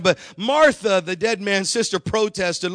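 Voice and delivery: ordinary talk, nothing marked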